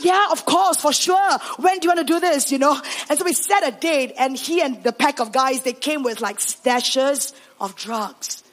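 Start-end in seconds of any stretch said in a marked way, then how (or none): 0.0-2.5 high-pitched, acted enthusiasm; keen, excited yes; eager agreement. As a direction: jump at the plan. Pastor agrees eagerly once understood.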